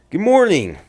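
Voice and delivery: high, bright, loud good morning; grandpa's cheery greeting. A narrator's reading. Grandpa greeting his granddaughter at breakfast, cheerfully. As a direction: greet her warmly like a happy grandpa. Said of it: "he said with a smile".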